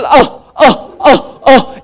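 high, repeated mock whiny grunts; exaggerated wordless throat catches; teenage throat noise. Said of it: "it's just a sound".

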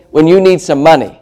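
ordinary talk

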